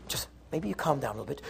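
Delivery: very soft, quick, low suggestion; hushed placating. Acted. Husband tells angry wife to calm down, hushed and placating. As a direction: tentatively soothe under your breath. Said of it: "then he says to her".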